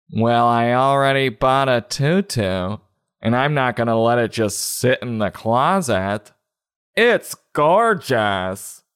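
drawn-out, put-on girly delivery; little girl defending purchase. Little girl justifying her new tutu, put-on and gushing. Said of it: "But then she's like".

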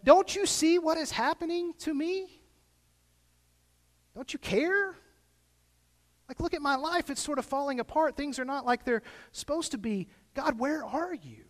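high, pleading cry to heaven; anguished plea to God. Retold or imitated. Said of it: "cried out and, and kind of looked up to God".

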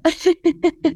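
high giggle; embarrassed laugh. Real, not acted. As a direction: break into a giggle. Laughs after stopping the lyrics.

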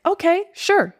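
eager AI assistant. Retold or imitated. Chatbot cheerfully agreeing to the user's request, chirpy. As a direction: play the overly willing chatbot saying sure. ChatGPT voiced as eager helper.

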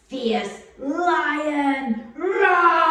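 loud, high playful roar; lion roar. Shown as a demonstration.

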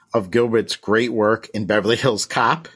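ordinary delivery

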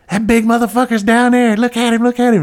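high, loud shrieking woman's voice; lady yelling from a balcony. Retold or imitated. Balcony lady yelling to the cops, high and loud. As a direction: shriek it down from above like a nosy neighbor. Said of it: "this lady on the balcony was like".

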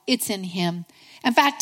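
ordinary talk, nothing marked

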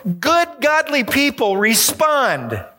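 high, put-upon complaining voice, a touch sung; mock indignant whine. Preacher mocking his own huff over unanswered texts, high indignant whine. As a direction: lampoon his own huffy impatience.

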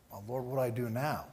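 soft, low private prayer; silent prayer. Retold or imitated. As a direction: act out praying under your voice. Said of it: "And now silently".

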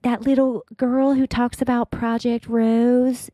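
lightly mocking baby voice; mock little girl voice. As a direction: mimic the childish Instagram voice. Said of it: "It doesn't sound like the whole".

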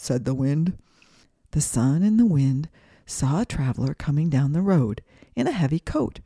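ordinary delivery

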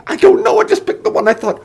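high, frantic yelling; panicked yell. A narrator's reading. Taryn defends his choice of bottle, yelling high. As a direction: yell back helplessly, high-pitched.

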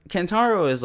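ordinary delivery

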